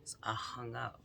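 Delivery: ordinary delivery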